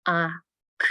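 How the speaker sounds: "a, k" as isolated short sounds; "a, k" segmented for blending; separate phonemes. Shown as a demonstration. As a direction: say "a" and "k" apart, with a clear gap. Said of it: "I'm gonna say each phoneme".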